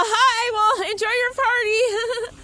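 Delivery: loud, high sarcastic call to the van. Real, not acted. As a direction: shout it over, dripping sarcasm. Calling out to the men.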